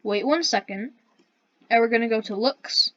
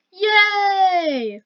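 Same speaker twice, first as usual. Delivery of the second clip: squealed high "yay"; high cheer; 9 semitones higher, 6 dB louder than usual. Pretend. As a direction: cheer it like winning a prize. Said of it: "let's record".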